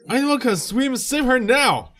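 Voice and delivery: high, loud call for help; urgent shout. Acted. Bystander yelling for someone to save the girl, urgent. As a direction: yell for a swimmer to save her.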